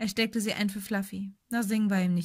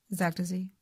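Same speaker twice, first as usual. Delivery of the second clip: plain reading voice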